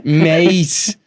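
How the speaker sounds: thick put-on Scouse lad, chatty; Scouse accent. Lad telling his pal about last week, in a put-on Scouse accent. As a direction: play a Liverpool lad gossiping about his mate. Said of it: "sing in the Scouse accent".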